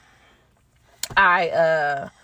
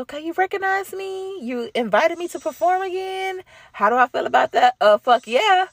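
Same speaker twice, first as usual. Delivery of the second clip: excited high voice; 12 semitones higher than usual. Retold or imitated. Replaying her thrilled answer to the event host, high and excited. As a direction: gush at being invited back. Excited reply to being reinvited.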